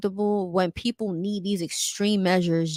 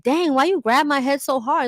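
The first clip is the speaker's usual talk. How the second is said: higher-pitched, mildly indignant protest; mock complaint; 6 semitones higher, 4 dB louder than usual. Woman to guy who grabbed her hair, mock complaint. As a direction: complain as if retelling a small grievance.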